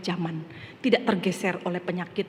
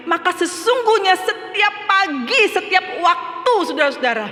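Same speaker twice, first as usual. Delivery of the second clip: high, loud, impassioned sermon voice; preaching at full force; 9 semitones higher, 7 dB louder than usual. Acted. Preacher urges the congregation that Christ counsels them, in full-force preaching. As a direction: drive the point home to the church.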